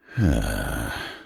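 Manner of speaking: long heavy sigh; troubled, drawn-out exhale; weary sigh. Acted. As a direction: let out a big breathy sigh. Troubled table sighs between lines.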